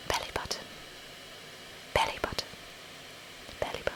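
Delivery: soft ASMR whisper. Shown as a demonstration. Saying belly button for a viewer, whispered. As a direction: whisper it close and quiet. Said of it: "I'm gonna say belly button for you".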